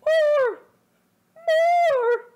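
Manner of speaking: high, loud desperate cries; loud cry. Acted. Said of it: "Naruto cried".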